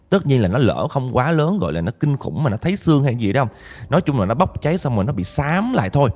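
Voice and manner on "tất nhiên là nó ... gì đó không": usual speaking voice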